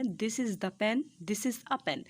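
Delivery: teacher slowly reading the example sentences; slow board reading. Shown as a demonstration. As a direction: read both sentences slowly. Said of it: "दो sentence मैंने नीचे लिखे हैं".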